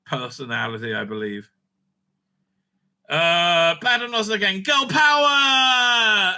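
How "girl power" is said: slow, high, loud mocking cheer; mock slogan shout.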